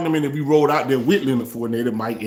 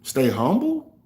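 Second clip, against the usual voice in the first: incredulous question; slower than usual. Real, not acted. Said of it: "Did y'all stay humble?".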